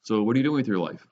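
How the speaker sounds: normal talking voice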